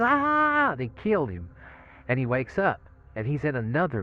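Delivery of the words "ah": a short, sharp, high yell of terror, much louder; retold scream. In the gas chamber as the pellets drop, a piercing high scream. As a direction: scream "Ah!" in sudden panic. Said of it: "and he screams".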